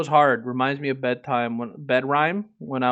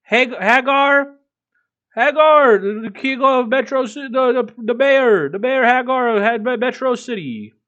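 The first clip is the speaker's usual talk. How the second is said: much higher and louder, blurting the name out; excited yell; 11 semitones higher, 10 dB louder than usual. Real, not acted. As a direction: shout it out, tripping over your words in excitement. Excited outburst about Hagar.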